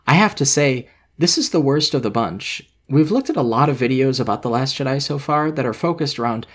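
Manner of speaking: normal talking voice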